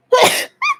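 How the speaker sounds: sudden high-pitched sneeze; a real sneeze breaking off mid-sentence. Real, not acted. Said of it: "I don't know why I'm sneezing".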